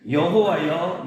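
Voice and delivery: ordinary delivery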